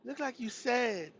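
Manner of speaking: a hint of her high voice. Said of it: "She's like".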